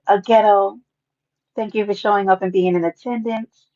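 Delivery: ordinary delivery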